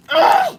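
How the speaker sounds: very high exasperated squeal; frustrated shriek. Real, not acted. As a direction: shriek in sudden annoyance. Cat ears interrupt thanks.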